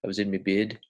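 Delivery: Aussie vowels, bed as 'bid'; demonstrated Australian accent. Shown as a demonstration. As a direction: imitate broad Australian speech. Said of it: "sounds a little bit more like bid".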